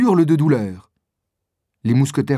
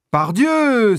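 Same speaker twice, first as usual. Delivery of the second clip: much higher, ringing exclamation; exclaiming; 9 semitones higher, 3 dB louder than usual. Acted. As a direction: burst out with joy at seeing friends. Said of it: "s'écrie D'Artagnan".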